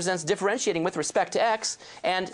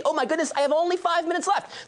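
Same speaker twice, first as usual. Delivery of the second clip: pitched up high in sudden alarm; surprised dismay; 9 semitones higher than usual. Real, not acted. Lecturer realising he has five minutes left, flustered surprise. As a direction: blurt it out as you spot the clock. Teacher realises time is short.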